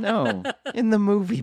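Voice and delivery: high, loud words through laughter; really laughing while talking. Real, not acted. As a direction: crack up while correcting a friend. Laughs at the mix-up.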